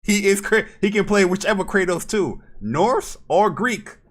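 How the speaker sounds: loud, high, laughing insistence; loud insistent. Real, not acted. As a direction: shout it, cracking up.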